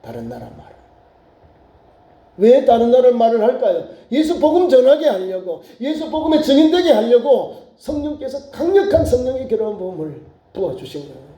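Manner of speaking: ordinary talk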